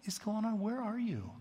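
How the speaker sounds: high-pitched, baffled plea; acted exasperated appeal; crying out to God. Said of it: "he's crying out to the Lord".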